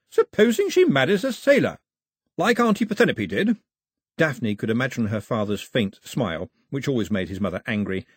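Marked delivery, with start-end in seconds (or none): 0.1-3.6 put-on high, loud pointed old lady; pointed grandmother. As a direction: needle him with it.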